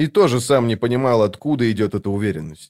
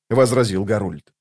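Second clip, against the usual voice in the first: ordinary delivery, nothing marked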